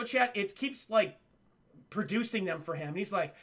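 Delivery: ordinary talk, nothing marked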